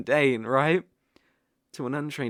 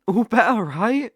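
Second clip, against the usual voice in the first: cheery delight; 7 semitones higher, 8 dB louder than usual. Acted. Cheerful after healing spell.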